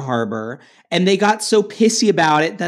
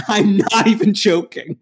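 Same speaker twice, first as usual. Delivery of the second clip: normal talking voice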